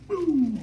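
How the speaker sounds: very high falling whoop; imitated siren whoop. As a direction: mimic a quick siren blip, pitch dropping. Imitates police siren after turning around.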